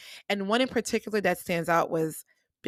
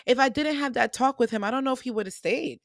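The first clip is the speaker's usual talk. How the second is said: usual speaking voice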